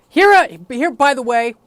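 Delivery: a hammed-up flash of panic; nervous aside. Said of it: "was me being afraid that any member".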